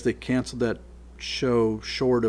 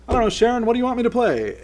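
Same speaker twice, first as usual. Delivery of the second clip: high, raised quoted voice; 12 semitones higher, 6 dB louder, faster than usual. Retold or imitated. Star at the piano asking [name] for a song, high quoted voice. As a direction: lift it high, asking openly. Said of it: "and he's like".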